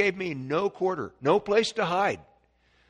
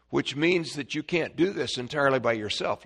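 usual speaking voice

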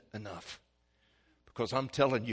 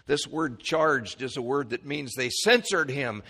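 ordinary delivery

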